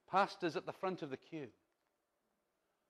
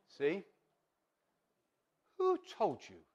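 ordinary talk, nothing marked

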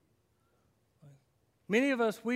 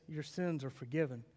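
quiet Jesus line; 7 semitones lower, 10 dB quieter than usual. Acted. Jesus' words repeated softly.

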